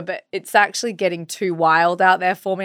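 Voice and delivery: ordinary delivery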